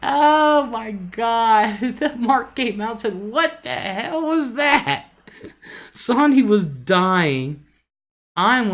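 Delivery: high, loud, breathless laughter through the words; laughing hard. Real, not acted. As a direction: crack up as you tell it, barely getting words out. Laughing at his intercom fart prank.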